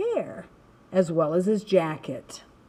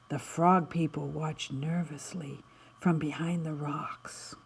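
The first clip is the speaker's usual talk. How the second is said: hushed, softer storybook whisper; 6 semitones lower, 8 dB quieter than usual. A narrator's reading. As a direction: whisper it as if the frogs might hear. Nervous frogs hiding behind rocks.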